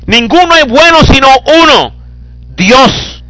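high, loud preaching over the congregation; fiery preaching. Acted. Preacher reading Jesus's words to his congregation, fiery and loud. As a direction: proclaim it at full force from the pulpit.

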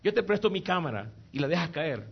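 normal talking voice

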